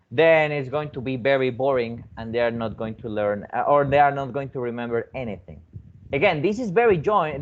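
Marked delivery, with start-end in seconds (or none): none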